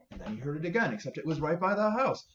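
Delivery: usual speaking voice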